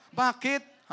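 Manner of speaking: sudden high Bakit, puzzled; high surprised question. Retold or imitated.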